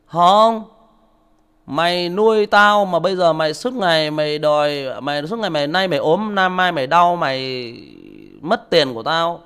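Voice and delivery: high, loud contemptuous yell; insulting son. Retold or imitated. Son cursing his father, high loud yell. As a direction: scream insults at the old father. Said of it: "Nó chửi lại".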